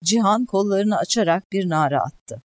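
usual speaking voice

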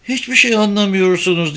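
put-on girl repeating herself, pitched high; insistent repetition. A narrator's reading. Girl repeats her protest to questioners, high-pitched insistence. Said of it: "diye tekrar etti kız".

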